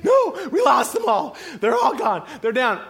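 high, loud, hammed-up wail; frantic panic. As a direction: shout the bad news in mock despair. Herders wail the news.

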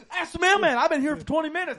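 high, loud, hurried shouting; frantic retold appeal. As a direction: yell for a witness, acting it out. Retold man appeals to the mailman.

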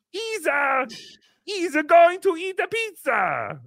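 high, slow, badly attempted Jamaican accent. Host proving he can't do a Jamaican accent, high and slow. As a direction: fumble a Jamaican accent on purpose. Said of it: "I'm bad at accents".

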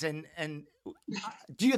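stumbling over the words, restarting; stuttering. Real, not acted. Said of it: "I'm stuttering over here".

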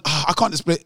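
normal talking voice

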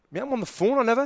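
high-pitched retold protest; indignant protest. Passenger on the phone denying it to the driver, indignant.

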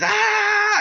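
high, sustained mock battle scream; imitated war-face yell. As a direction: demonstrate a war cry in a high held "aah". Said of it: "they all start yelling".

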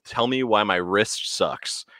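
ordinary delivery

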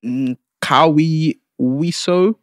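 sounding out a place name; slowly sounding out each syllable; slow careful reading. Real, not acted. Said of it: "I don't know how I'm gonna say this".

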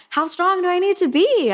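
high, indignant complaint; mock self-pitying protest; whiny protest. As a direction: whine like you're hard done by. Self-pity at teacher.

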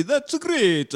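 put-on parroting "That's great" in a vague European accent; mock not-Japanese accent, a hint of French; mock odd accent. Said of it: "That's not a Japanese accent".

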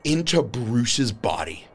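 intense blow-by-blow storytelling; dramatic game master narration. Acted.

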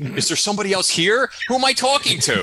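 high, loud mock outrage through a laugh; mock indignant yell. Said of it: "I'm like".

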